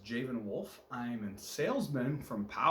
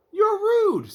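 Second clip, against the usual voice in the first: indignant; 12 dB louder than usual. Acted. Indignant retort to reporter.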